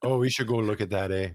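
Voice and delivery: mock Canadian cop, easygoing; Canadian accent. Joking Canadian cop talk.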